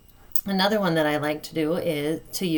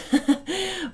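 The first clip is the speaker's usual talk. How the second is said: a bright burst of laughter; short laugh; 13 semitones higher than usual. Real, not acted. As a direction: laugh at your own slip. Laughs at own slip.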